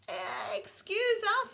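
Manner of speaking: put-on high, polite little boy; polite child voice. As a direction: excuse yourself like a well-mannered boy. Walter remembering manners.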